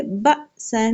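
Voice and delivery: بأسن with a sudden catch after "ba", then held "sann"; بأسن as "ba'-sann", abrupt then sustained; jolt on hamza. Shown as a demonstration. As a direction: jerk the first syllable of بأسن short without breaking breath. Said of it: "ہمیشہ دیتے ہیں جھٹکا".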